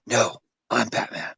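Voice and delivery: mock Batman, low and hushed. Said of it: "Batman talks like this".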